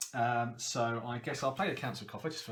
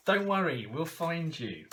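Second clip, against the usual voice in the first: higher, louder, then slowing on find you; reassuring promise; 5 semitones higher, 5 dB louder than usual. Pretend. Promising the kidnapped character card a rescue, loud and reassuring. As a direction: call out a promise to rescue her.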